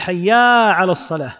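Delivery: "حي" ending long as "hayyaaa"; overlengthened yaa. Shown as a demonstration. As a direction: hold the last vowel of "حي". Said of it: "أن يشبع الياء". (إشباع ياء «حي»: حيّااا)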